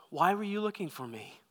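gently, no alarm at all; calm. Retold or imitated. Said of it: "he very calmly says".

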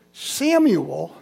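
normal talking voice

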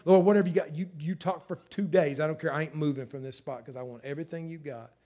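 loud, driving prayer voice; a preacher praying with full force; fervent preaching. Real, not acted. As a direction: claim it boldly for the church.

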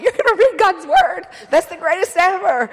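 high, loud, laughing delight; thrilled reaction. Retold or imitated. Wife reacting to husband's promise to read, thrilled.

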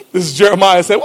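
normal talking voice

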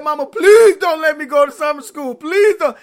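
put-on loud, whiny begging child; mock tantrum. Child throwing a tantrum at mama, loudly begging. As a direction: wail it like a spoiled brat. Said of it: "I threw a full-blown tantrum".

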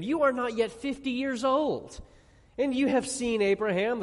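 high, incredulous challenge, acted out; mocking. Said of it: "they're kind of mocking him".

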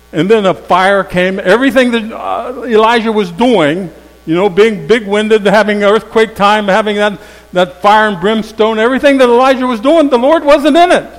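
loud, high fire-and-brimstone preaching; fiery preaching. Acted. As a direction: build it up like thunder from the pulpit. Preaching the fire passage at full force.

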